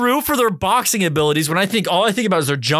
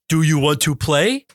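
slow, deliberate imitation of signed speech; signing to an animal; 3 semitones lower, slower than usual. Owner signing to pet gorilla, slow stilted voice. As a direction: space it out as if signing each word. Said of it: "having sign language with your animal".

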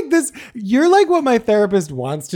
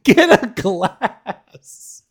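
laughing; 7 semitones higher, 6 dB louder, much slower than usual. Real, not acted. Laughing at friend's water spit.

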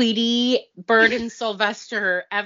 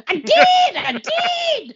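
Tweety voice; 6 dB louder, slower than usual. Retold or imitated. Tweety catchphrase again.

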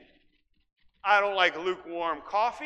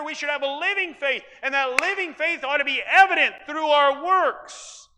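louder, higher pulpit preaching; preaching at full force; 5 semitones higher, 6 dB louder than usual. Acted. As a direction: drive the point home, louder. Preacher builds on living faith.

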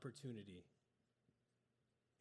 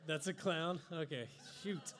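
loud, higher words through real laughter; laughing; 3 semitones higher, 6 dB louder than usual. Real, not acted. Preacher hears his Jesus looks like a clown, laughing. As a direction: protest while still laughing. Laughing at own bad drawing.

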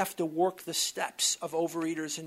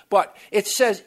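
usual speaking voice

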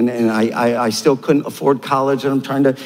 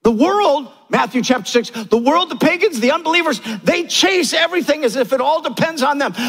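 ordinary delivery

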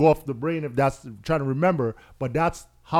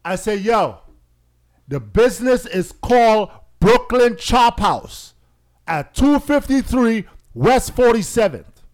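louder and higher, slowing on the address; retelling the cabbie argument, heated and loud; 7 semitones higher, 8 dB louder than usual. Retold or imitated. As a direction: hammer the address at the cabbie, getting worked up. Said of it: "I started wilding out".

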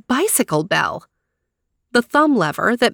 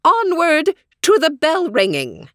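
imperious plummy grande dame; 9 semitones higher than usual. Acted. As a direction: call the crowd onward imperiously. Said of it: "she shouted".

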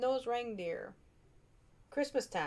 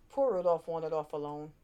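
ordinary delivery, nothing marked

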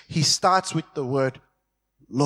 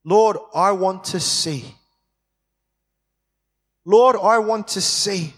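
pleading cry; 3 semitones higher, 4 dB louder than usual. A narrator's reading. Blind man begs Jesus for sight, rising plea. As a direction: LORD pressed, growing louder. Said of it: "The blind man's response".

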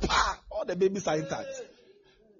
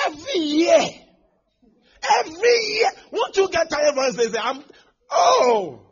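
crying out high and loud, voice near breaking; anguished cry; 11 semitones higher, 17 dB louder, slower than usual. Real, not acted. Preacher crying out to the crowd in despair. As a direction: wail it out in despair.

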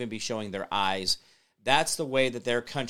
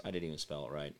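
ordinary delivery

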